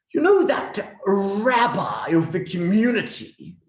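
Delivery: ordinary delivery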